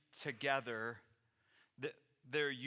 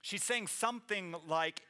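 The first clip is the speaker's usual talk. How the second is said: ordinary delivery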